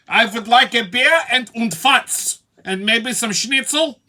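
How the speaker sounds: loud, high hammed-up German accent; mock German accent. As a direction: order like a comic, shouty German. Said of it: "walk into a fucking beer garden".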